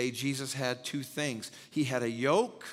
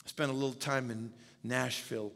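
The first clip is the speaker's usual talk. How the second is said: usual speaking voice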